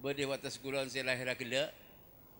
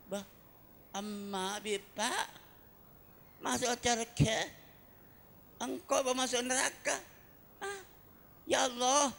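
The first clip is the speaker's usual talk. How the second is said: put-on high wailing child's voice crying to God; a child protesting to God, high and pleading; 10 semitones higher, 6 dB louder than usual. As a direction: beg God like a desperate child.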